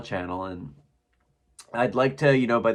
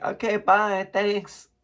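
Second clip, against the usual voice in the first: put-on girl voice; 8 semitones higher, slower than usual. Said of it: "this girl's like".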